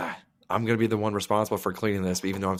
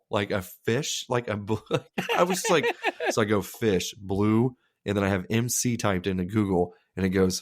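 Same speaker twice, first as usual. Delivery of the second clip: normal talking voice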